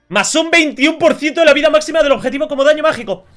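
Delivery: high, loud mock announcer voice. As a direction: read it out like a booming announcer. Reading item text aloud. (voz de locutor alta y fuerte)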